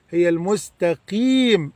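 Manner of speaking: usual speaking voice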